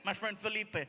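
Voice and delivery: ordinary delivery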